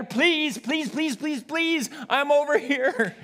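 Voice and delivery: put-on high, pleading child voice; a child pleading with the preacher, high and eager; begging children. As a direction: beg like a kid desperate to be chosen. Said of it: "palms are pressed together".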